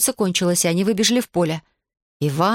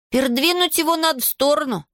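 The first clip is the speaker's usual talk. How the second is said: high-pitched call to act; urgent cry; 9 semitones higher than usual. A narrator's reading. Алик urges moving Иван off the road, urgent cry. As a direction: call it out high, without much volume. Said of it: "крикнул Алик".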